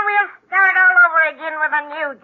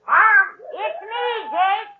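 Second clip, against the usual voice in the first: ordinary talk, nothing marked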